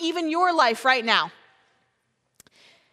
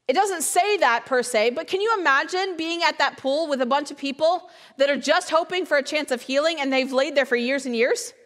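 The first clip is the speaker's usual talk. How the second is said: ordinary delivery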